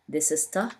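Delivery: ordinary delivery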